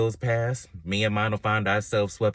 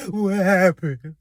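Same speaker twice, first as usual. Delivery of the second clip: high, loud, drawn-out cry; mock self-questioning wail; 9 semitones higher, 5 dB louder, much slower than usual. Jilted lover asking herself, mock bewildered wail. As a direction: wail it slowly in comic bewilderment. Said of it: "you ask yourself".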